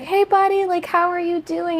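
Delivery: high, sweet reporter voice; imitating a kind reporter. Reporter gently asking a crying schoolkid, high and sweet. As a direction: coax the kid like a gentle reporter. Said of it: "the reporter's like".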